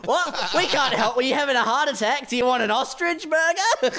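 high voice breaking into laughter; laughing mock doctor. Doctor-owner offering burgers to a patient, laughing high. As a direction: play the ostrich doctor through laughs. Doctor-themed restaurant greeting customer.

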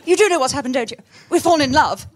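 put-on loud, high, staccato 1940s British film accent; clipped 1940s British accent. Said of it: "very staccato".

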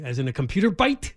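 ordinary talk

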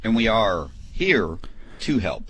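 slowed-down slogan read; slow slogan delivery. Shown as a demonstration. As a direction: stretch out "And we are here", slow and deliberate. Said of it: "I gotta change it up".

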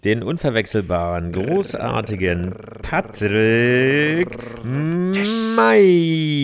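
booming ring announcer, stretched and rising; brash fight promoter hype. Acted. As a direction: call him into the ring like a title fight. Boxing corner introduction of Patrick.

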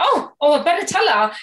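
high, loud eager realization. Retold or imitated. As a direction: voice a person who suddenly opens up. Relieved listener voiced in story.

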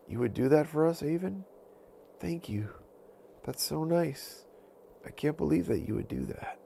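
a wolf who can hardly believe it; touched wolf. Acted. Wolf thanking Avon for guarding, lightly voiced amazed gratitude.

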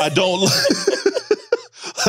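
breaks into high laughter mid-word; can't get the words out for laughing; giggling through a confession. Real, not acted. Laughs mid-sentence about sex.

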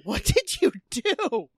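hammed-up exasperation; mock dismay. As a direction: plead with him in comic disbelief. Mock exasperation at Billy Zane.

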